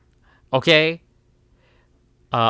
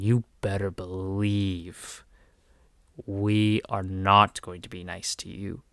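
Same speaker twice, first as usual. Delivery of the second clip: lower, softer, slow threat; low menace; 6 semitones lower, 7 dB quieter, slower than usual. Real, not acted. Warning the game makers their turn is coming, low quiet menace. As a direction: lean in and warn them quietly.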